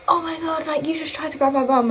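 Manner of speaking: high-pitched retold accusation; indignant imitation in a high voice; mock outrage. Retold accusation of bum grabbing.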